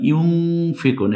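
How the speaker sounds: ইউনফিকুন with the n held as a nasal ng; nasal ikhfa. Shown as a demonstration. Teacher explains noon before fa, nasal ikhfa. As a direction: send the ন of ইউনফিকুন into the nose. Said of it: "একটু নাকের বাসিতে নিয়ে অনিঃসারের মতো".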